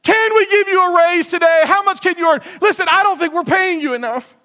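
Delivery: mock boss showering praise; gushing boss. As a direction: gush over the new hire like a flattering boss. Flattering boss quoted in sermon.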